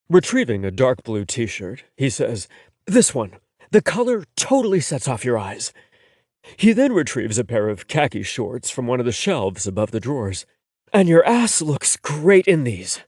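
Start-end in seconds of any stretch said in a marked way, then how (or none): 2.8-5.7 a little higher, easy and fond; light boyfriend voice, teasing praise; a hint of a playful boyfriend; lightly voiced boyfriend. 10.9-13.0 slow, higher, teasing; a hint of a cheeky boyfriend.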